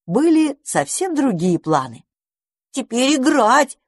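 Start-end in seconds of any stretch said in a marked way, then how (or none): 2.7-3.8 put-on eager high squirrel kids; slow, high, insistent demand. A narrator's reading. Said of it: "потребовали они".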